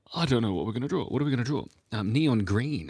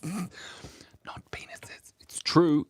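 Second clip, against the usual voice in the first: hushed whisper; sheepish whispered aside; 10 semitones higher, much slower than usual. Real, not acted. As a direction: keep it under your breath, half laughing. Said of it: "You've gotta say it out loud".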